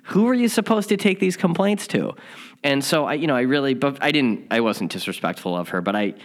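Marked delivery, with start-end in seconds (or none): 0.0-2.1 higher, agitated demand for answers; worked up. Retold or imitated. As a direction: press her, getting worked up. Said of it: "just a little worked up".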